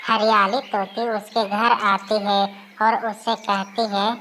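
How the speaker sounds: ordinary delivery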